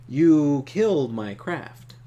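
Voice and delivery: slow, loud accusation; accusing player line. Acted. Player accusing Trakov, slow and loud. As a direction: lay the blame down slowly.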